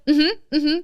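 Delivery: high, teary mm-hmms; crying through it. Retold or imitated. Daughter crying silently while mom talks, teary mm-hmms. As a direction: hold back sobs while nodding along. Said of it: "tears were going down my face".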